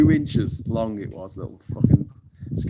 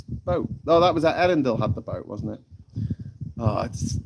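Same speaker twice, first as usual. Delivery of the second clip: ordinary talk, nothing marked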